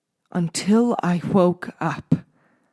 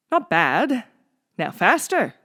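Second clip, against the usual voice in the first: ordering. A narrator's reading. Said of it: "Doctor Shriek ordered".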